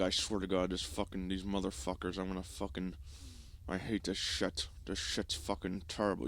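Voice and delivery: grind it out quietly, barely holding rage; talking through teeth. Retold or imitated. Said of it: "you start talking through your teeth".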